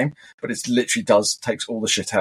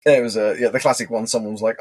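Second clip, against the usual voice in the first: ordinary talk, nothing marked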